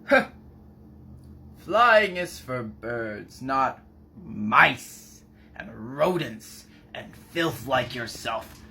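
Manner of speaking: slow, haughty storybook bird; scornful seagull. Acted. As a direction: scoff slowly at a lowly rodent. Voices scoffing seagull in fairy tale.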